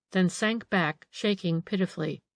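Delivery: ordinary delivery, nothing marked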